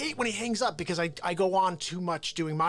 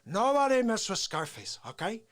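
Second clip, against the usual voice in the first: thick-accented gangster impression; Scarface; 3 semitones lower than usual. Comic as Pacino's Scarface talking to Manny, tough impression. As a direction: start tough, then drop lower and taunt. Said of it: "he was Scarface".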